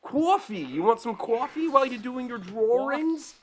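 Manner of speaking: very high, exaggerated Boston accent; squeaky hammed-up Massachusetts accent. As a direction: lay on "cwoffee" and "drawrings" like a Boston local. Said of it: "think of the Boston accent".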